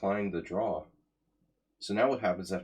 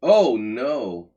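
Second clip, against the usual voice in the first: 1 loud dismay; 4 semitones higher, 12 dB louder than usual. Real, not acted. Opponent's surprise capture on board.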